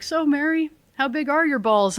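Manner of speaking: teasing call-out. Retold or imitated. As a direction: rib her in front of everyone.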